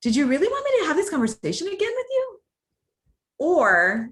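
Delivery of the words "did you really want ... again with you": much higher, fast, incredulous question; exaggerated questioning tone. Shown as a demonstration. To a coworker, exaggerated questioning tone. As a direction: push the pitch way up in disbelief. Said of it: "exaggerated so you can hear my pitch".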